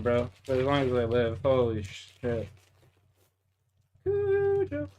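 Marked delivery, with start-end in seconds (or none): 4.1-4.9 Kudo squeaked very high and soft; a soft falsetto squeak; squeaky mock voice. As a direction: quieter falsetto self-tease. Mocks the name in high voice.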